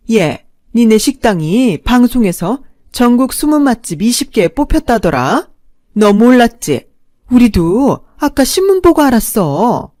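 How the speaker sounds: put-on loud, high, gloating old woman; a pushy mother-in-law, loud and pleased.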